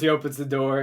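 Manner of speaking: ordinary delivery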